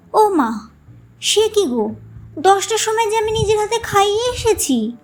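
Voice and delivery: high, astonished denial; indignant surprise. Acted. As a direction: protest you already fed him. Mother protests, surprised.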